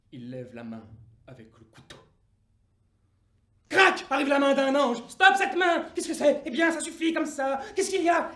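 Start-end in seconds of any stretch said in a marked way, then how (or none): 3.7-8.3 fort et aigu, soudain; arrête le geste d'un coup; irruption criée. Acted. L'ange arrête la main. (l'ange surgit, voix forte et haute)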